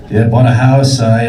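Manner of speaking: usual speaking voice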